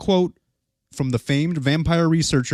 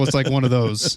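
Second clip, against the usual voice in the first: normal talking voice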